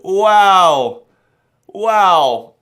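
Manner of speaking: high, loud, drawn-out wow; amazed wow. Acted. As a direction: blown away by a big reveal, voice up. Reacts to the camera reveal.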